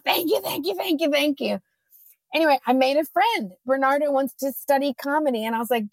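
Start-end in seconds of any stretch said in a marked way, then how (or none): 0.0-1.6 high, soft, grateful thank-yous; imitating gushing relief, higher and softer; on her knees. To the airline staff: soft, high, grateful begging. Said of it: "like, on my knees".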